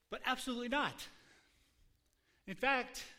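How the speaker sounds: loud, emphatic flat refusal; defiant refusal. Acted. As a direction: slam the door on the request. Pharaoh refusing Moses, acted.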